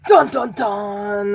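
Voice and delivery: slow, drawn-out dun dun dun; mock suspense sting. Reader teases a rival for Virgo, mock suspense sting. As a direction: intone a hammy cliffhanger sting.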